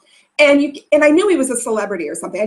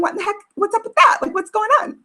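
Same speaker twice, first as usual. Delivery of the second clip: voiced higher as a baffled husband; high puzzled husband; 10 semitones higher, faster than usual. Husband watching from across baggage claim, baffled. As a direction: play the confused husband, pitched up. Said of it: "he's wondering".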